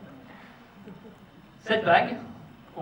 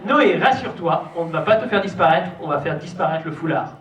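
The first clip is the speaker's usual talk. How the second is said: usual speaking voice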